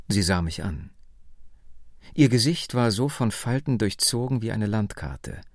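usual speaking voice

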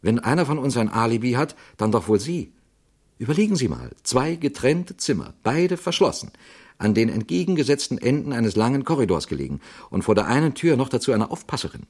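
the actor defends the Italian woman, high and insistent; agitated actor. Acted. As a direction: argue it, mounting in pitch. Actor argues the alibi, heated.

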